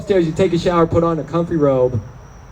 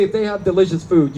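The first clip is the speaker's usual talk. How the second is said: usual speaking voice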